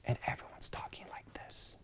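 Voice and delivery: hushed whisper, barely any voice. Shown as a demonstration. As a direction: show how quietly everyone talks in the mall. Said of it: "real quiet".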